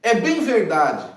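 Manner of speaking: quoting the disciple's phrase, slow and emphatic. Retold or imitated. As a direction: lean into "bem verdade", drawn out. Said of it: "ele usa uma expressão".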